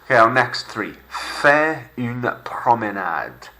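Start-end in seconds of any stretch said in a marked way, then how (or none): 1.1-3.5 Faire une promenade slowly with French r; slow French model. Shown as a demonstration. Teacher gives phrase to repeat, slow careful French.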